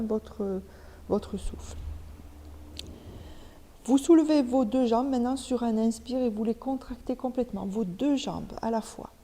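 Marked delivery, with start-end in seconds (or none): none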